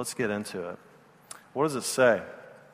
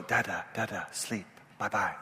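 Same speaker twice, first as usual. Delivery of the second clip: put-on softer little-girl voice saying goodnight; soft toddler goodbye; 4 semitones lower, 11 dB quieter than usual. Toddler says goodnight to dad, soft. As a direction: tuck him in gently, then leave.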